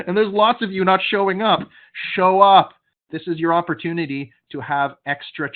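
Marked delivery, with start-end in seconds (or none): 0.1-2.7 louder, firm telling-off to the no-shows; raised voice, fed-up demand; stern scolding. Real, not acted. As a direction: scold the absent ones like a coach. Scolding absent attendees.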